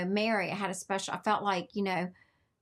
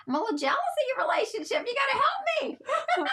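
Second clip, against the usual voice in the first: high, fast, loud mock-desperate cry; playful jealous plea; 5 dB louder, faster than usual. Real, not acted. Host teasing her guest about her relationship, playfully jealous. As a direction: burst into a laughing, joking plea.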